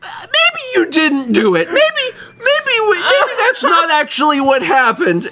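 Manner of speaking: mom covering up. Retold or imitated. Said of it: "my mom is like".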